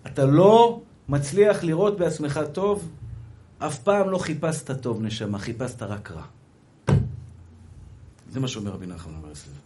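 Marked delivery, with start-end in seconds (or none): none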